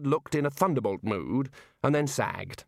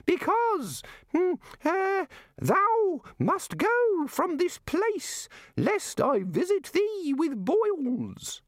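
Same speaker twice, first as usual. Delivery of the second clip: very high, slow, hesitant threat; slowly bluffing tiny god; panicky god; much slower than usual. A narrator's reading. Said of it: "looked slightly panicky".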